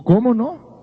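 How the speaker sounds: sudden high, eager "¿Cómo no?"; eager agreement. Retold or imitated. Daring friend eagerly agrees to break the window, a high keen yes. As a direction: jump at the chance like a daring kid. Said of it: "y dijo".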